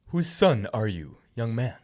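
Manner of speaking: slow, stately king's question; slow kingly question. Acted. King Saul questions the young David, slow and regal. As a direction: ask it with royal weight, slowly.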